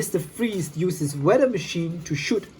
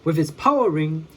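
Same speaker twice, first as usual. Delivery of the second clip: ordinary delivery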